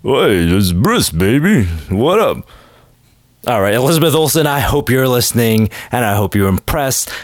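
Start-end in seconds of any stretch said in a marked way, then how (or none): 0.0-2.5 put-on, slow Stallone-like accent; swaggering stab at a Stallone accent; Sly Stallone. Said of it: "sounds like Sly Stallone to me".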